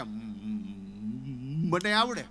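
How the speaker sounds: stuck on the first sound, then blurts the rest; stammering friend. Retold or imitated. Friend who stammers, agreeing to come along, stuttering. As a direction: stutter hard on the opening syllable. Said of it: "એને થોડીક break ચોંટે છે બોલવામાં".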